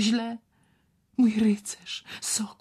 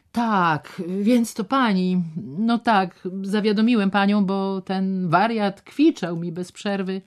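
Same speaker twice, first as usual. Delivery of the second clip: gruff doctor; 5 semitones higher, 5 dB louder than usual. Acted. Doctor answers Ewa gruffly.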